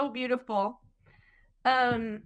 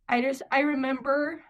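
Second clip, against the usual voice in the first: ordinary talk, nothing marked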